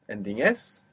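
normal talking voice

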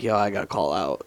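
low, quiet, half-asleep mumble; groggy just-woke-up voice. Retold or imitated. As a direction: sound like you just rolled out of bed. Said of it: "and they're like".

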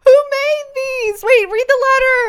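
high, loud, tearful excitement; teary excited squeal. Real, not acted. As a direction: gasp out the question, overjoyed. Co-host excited over gift.

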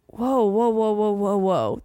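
retold startled whoa, whoa; mock alarm. Telling a friend how she reacted, mock startled. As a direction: back off in mock surprise. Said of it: "And I'm like".

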